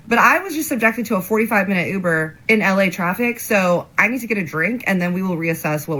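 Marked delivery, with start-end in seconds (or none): none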